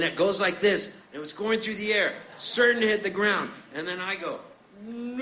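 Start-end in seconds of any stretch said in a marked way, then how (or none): 4.8-5.2 long, high, softened "no"; mock-dramatic cry. Diving to catch the falling host, hushed mock-dramatic wail. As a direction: stretch it out like a slow-motion movie cry.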